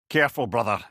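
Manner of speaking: ordinary talk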